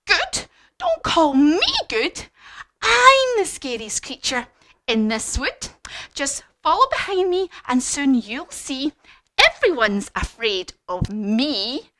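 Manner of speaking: high, loud, indignant bluster; brave little mouse. Acted. Mouse facing the Gruffalo, bold bluster. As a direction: puff yourself up and declare it slowly. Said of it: "tries to look as brave as possible".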